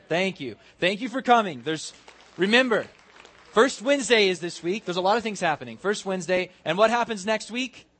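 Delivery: high, loud send-off to a crowd; rousing closing announcements. Real, not acted. Preacher dismissing the congregation with announcements, loud and upbeat. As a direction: project excitedly over the whole room.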